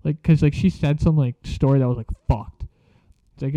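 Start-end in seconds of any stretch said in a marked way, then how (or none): none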